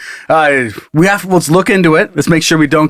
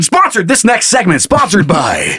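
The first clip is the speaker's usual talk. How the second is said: mock ad read; 4 semitones higher than usual. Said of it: "mock sponsor read".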